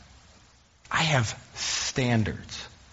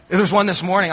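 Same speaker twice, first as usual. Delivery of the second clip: the same line again, louder and higher; louder repeat; 7 semitones higher, 8 dB louder, faster than usual. Real, not acted. Pastor repeating his point to the church, louder. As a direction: punch it out to the congregation. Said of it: "I'm preaching on this".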